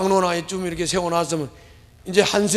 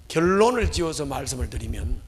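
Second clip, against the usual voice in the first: ordinary talk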